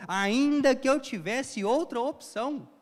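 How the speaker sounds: fervent resolve. Retold or imitated. Preacher voicing love that chooses to forgive, fervently. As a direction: declare it like a conviction. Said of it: "É esse amor".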